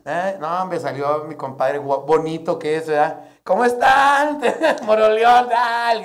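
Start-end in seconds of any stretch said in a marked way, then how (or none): 3.4-6.0 much higher and louder victorious greeting; triumphant shout. Retold or imitated. The comic triumphantly greets the Moroleón crowd, loud high shout. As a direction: greet the arena like a conquering hero. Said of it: "grité yo, triunfando".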